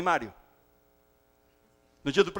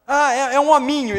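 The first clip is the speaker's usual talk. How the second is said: nurse announcing; 4 semitones higher, 8 dB louder than usual. Acted. Nurse answers father.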